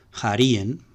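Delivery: "jarien" stressed on RI, the second-to-last syllable; paroxytone stress. Shown as a demonstration. Teacher giving the neuter, "jarien" paroxytone like the masculine. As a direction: hit RI in "jarien". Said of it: "paroxítono como el masculino".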